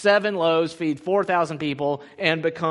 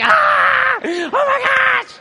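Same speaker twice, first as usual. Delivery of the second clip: much higher, loud panicked yell; freaked-out yelp; 11 dB louder, much slower than usual. Retold or imitated. Pastor imitating Matt freaking out about the parking lot, panicked yell. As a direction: yelp like you just heard terrible news. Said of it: "he freaked out too".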